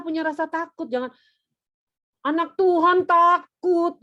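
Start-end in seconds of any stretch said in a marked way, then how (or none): 2.2-4.0 loud, high, drawn-out reproach; mock scolding. Parent shaming a frightened child, mock-scolding. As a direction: stretch it out in exaggerated disbelief.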